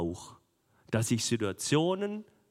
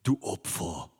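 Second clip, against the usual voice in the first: mocking pupil; 8 semitones lower than usual. Preacher mimics his pupils' insult, low and sneering. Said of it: "wisst ihr, was die sagen würden?".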